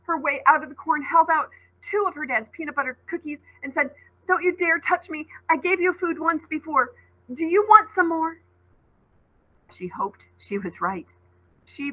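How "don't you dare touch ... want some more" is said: high, bold, defiant dare; burst of courage. Acted. Scared girl daring the beast to back off, boldly. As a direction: stand up to it, pitched up and daring. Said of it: "on a burst of courage".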